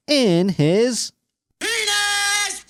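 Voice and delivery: very high hammed-up squeal; mock horror. Host reading the article aloud, mock horrified. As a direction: shriek the last word in pretend horror.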